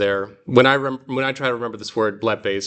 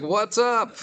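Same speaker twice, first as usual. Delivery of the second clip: much higher breezy what's up; high casual greeting; 11 semitones higher than usual. Retold or imitated. Teacher voicing Mary greeting casually. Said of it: "She's like".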